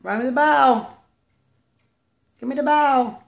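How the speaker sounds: sing-song fetch request; high sing-song calling. Real, not acted. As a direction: coax the bird to bring the ball.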